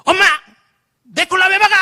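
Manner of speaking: high, strained yell at mom; acted angry outburst. Pastor as his teenage self yelling at mom over cola, angry outburst. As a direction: act out an angry teen's protest. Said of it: "저는 그때까지 너무 화가 났어요".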